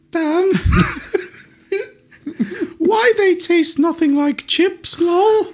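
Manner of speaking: high, slow mocking whine; mock girlfriend voice, high and drawn out; girlfriend's voice. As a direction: mimic her in a squeaky mocking tone. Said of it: "she looked at me and said".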